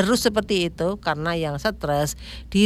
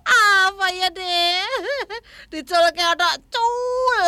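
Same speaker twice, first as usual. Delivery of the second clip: laughing; 9 semitones higher, slower than usual. Real, not acted. Talking on after helpless laughing fit.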